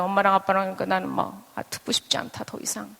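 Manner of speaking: soft, fading to a near whisper; pitiful child. Retold or imitated. Child tuning out arguing parents, hushed and withdrawn. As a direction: shrink away, imitating a withdrawn child. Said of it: "아니면 쟤는 너무 불쌍하죠".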